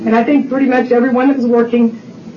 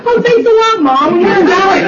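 retold teenage comeback; mock indignant daughter; 9 semitones higher than usual. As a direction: throw it back at Mom, pitched up. Daughter's sarcastic retort, retold.

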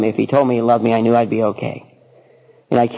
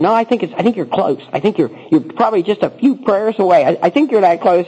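higher, gently encouraging voice; reassuring sponsor; 7 semitones higher than usual. Retold or imitated. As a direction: coax him along kindly.

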